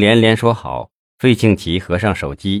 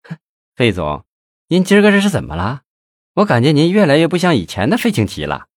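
teasing chuckle; 5 semitones higher than usual. Acted. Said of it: "向丽呵呵一笑".

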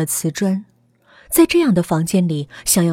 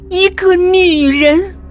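slow dramatic reveal; 10 semitones higher, 8 dB louder, much slower than usual. A narrator's reading. Ghost revealed, slow and high.

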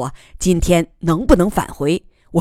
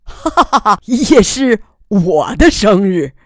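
laughing delight; 4 semitones higher, 4 dB louder than usual. Acted. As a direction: laugh at the coincidence. Laughs, shared birthday.

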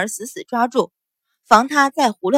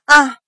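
loud, high cry of pain; sharp yelp of pain; pained shriek; 4 semitones higher, 8 dB louder than usual. A narrator's reading. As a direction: cry out as the arm is twisted. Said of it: "樊香儿疼得尖叫".

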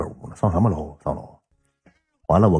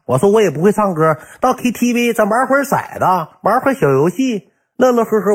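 兴奋答应; 9 semitones higher than usual. Retold or imitated. 兴奋答应去唱歌.

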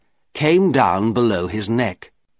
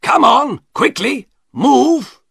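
high, loud, impatient orders; angry command; 13 semitones higher, 5 dB louder, slower than usual. A narrator's reading. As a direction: angrily hurry him along. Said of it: "said the shopkeeper angrily".

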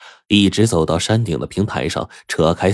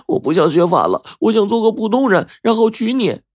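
much higher voice, pleading; desperate young man; 3 dB louder than usual. Acted. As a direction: insist desperately on marrying her. Shanzi pleads with Xiaomei.

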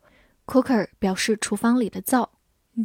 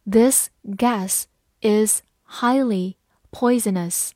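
slow, word by word; slow and clear, each word apart; slow reading; slower than usual. Shown as a demonstration. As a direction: read it slowly for learners. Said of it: "好，慢慢来读。".

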